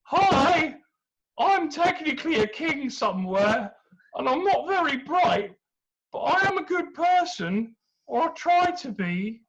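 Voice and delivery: high, loud, goofy self-introduction; a dim but earnest suitor; awkward hero. Acted. Perseus introducing himself to Andromeda, high goofy earnestness.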